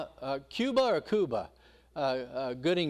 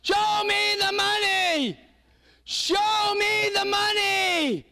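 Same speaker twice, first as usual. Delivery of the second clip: high, slow, drawn-out yell of "Show me the money"; 6 dB louder, slower than usual. Acted. Agent on the phone demanding his client be paid, yelling. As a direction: belt it out at the top of your voice. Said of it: "he's yelling in the office".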